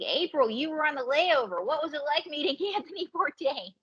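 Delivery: a lightly voiced, curious crowd; excited colleagues. Coworkers quizzing her about a celebrity layover, mildly excited.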